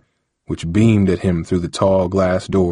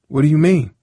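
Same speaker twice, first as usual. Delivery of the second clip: surprised; 8 semitones higher, faster than usual. Acted. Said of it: "surprised that Londell seemed".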